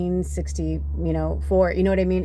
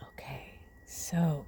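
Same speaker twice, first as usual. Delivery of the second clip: whisper; 10 dB quieter than usual. Real, not acted. Tarot reader whispering to her viewers. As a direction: breathe the words out like a secret.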